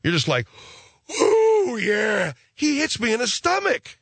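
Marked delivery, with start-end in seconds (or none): 1.2-2.3 high pained squeal of being winded; a punched-in-the-gut ooh. Retold or imitated. Punched in stomach.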